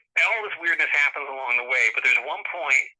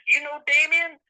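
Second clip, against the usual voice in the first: imitated Southern accent, much higher pitch; 13 semitones higher, 4 dB louder than usual. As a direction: mimic a Southern caller's rising question. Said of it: "like, heavy Southern accent".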